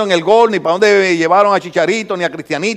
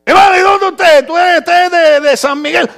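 predicador que pregunta; 7 semitones higher than usual. Retold or imitated. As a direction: act out probing a brother's hometown. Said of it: "le preguntó a los hermanos". (voz alta de preguntón, imitada)

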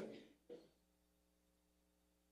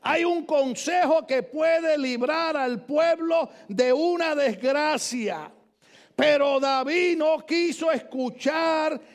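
slow, higher, louder pleading; preaching at full force; 6 semitones higher, 3 dB louder, much slower than usual. Acted. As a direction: plead with the king slowly. (predicando con fuerza)

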